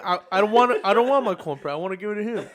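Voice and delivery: high, stammering comic voice; mock movie character. Acting out the movie's cornbread quarrel.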